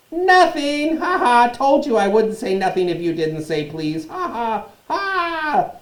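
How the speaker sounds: very high, loud, laughing taunt; cackling poltergeist. A narrator's reading. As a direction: crow with glee. Peeves cackling triumph.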